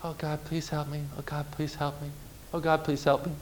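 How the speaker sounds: feeble, very soft little prayer; barely audible, timid plea; weak quiet prayer. Shown as a demonstration. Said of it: "your prayers might kinda be like this".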